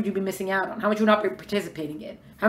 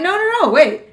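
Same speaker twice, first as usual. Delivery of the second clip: protesting no; 9 semitones higher, 7 dB louder than usual. Acted. Resisting the animal soul.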